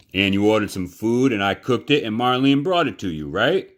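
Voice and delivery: loud and pressing, pitch rising; stern confrontation. Acted. Neil the cook corners Bryce about the unpaid meal, loud and stern. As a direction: lean on the boy, building pressure.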